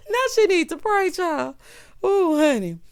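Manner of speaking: high, loud, just after a laugh; tickled exclamation. Real, not acted. Commentator reacting to "she need to pray", tickled and loud.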